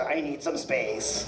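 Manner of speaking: mocking the man who wanted space. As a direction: repeat the stuck-up line softly and slowly. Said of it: "I prefer that to".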